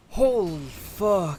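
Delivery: slow, stunned, high-pitched; drawn out in disbelief; gobsmacked. Real, not acted. Boss just killed.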